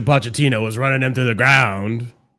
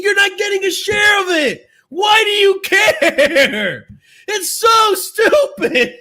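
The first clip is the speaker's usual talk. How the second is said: much higher, louder, shouting in real outrage; furious rant; 6 dB louder than usual. Real, not acted. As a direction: scream at the caller in real fury. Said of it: "I'm getting triggered again".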